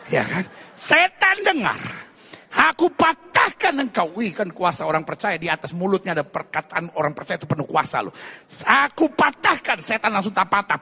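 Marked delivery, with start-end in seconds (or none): 0.7-4.1 high, forceful exorcism command; loud, high-pitched rebuke; commanding shout. Acted. Acts believer commanding a demon. 8.6-9.8 high, loud, slow order to a demon; forceful exorcism cry; commanding shout. Acted. Acts believer commanding a demon.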